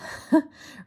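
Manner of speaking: high, loud burst of laughter. Real, not acted. Laughing while telling viewers about the crash. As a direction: laugh out at the memory. Everyone running in.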